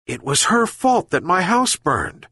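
a mild complaint, lightly voiced old man; complaining old man. A narrator's reading. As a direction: grumble it like a tired old fisherman. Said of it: "complained the old man".

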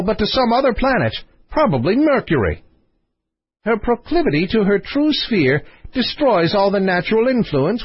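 high, emphatic philosopher voice; Copykeck expounding. Acted. Copykeck expounds his theory.

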